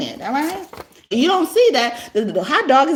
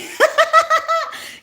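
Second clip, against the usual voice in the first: shrieking high laugh; high cackling laugh. Real, not acted. Laughs loudly at own song.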